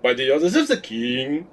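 hammed-up, outrageous French accent; the Master's French accent. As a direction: put on a dreadful fake French accent. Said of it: "that one where he goes".

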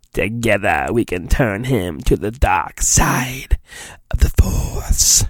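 low, soft, breathy menace trailing to a whisper; Emperor voice. Acted. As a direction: lure him to the dark side, low and hushed. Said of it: "The Imperial Emperor tells Vader".

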